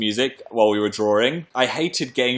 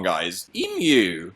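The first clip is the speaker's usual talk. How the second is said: ordinary talk, nothing marked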